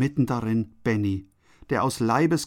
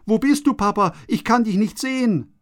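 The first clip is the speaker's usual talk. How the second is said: mock frightened cry, higher; child screaming in fear; 9 semitones higher, 3 dB louder than usual. A narrator's reading. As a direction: cry out blindly in the smoke. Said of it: "Benny schreit". (ängstlicher Schrei des Kindes, höher)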